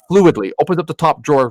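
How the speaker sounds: ordinary talk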